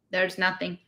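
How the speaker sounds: ordinary delivery, nothing marked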